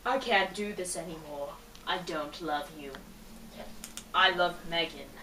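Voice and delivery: put-on low, subdued boyfriend voice; boyfriend breaking up. Boyfriend confesses to girlfriend that it's over, low and heavy. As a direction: drop the pitch well down for the boyfriend.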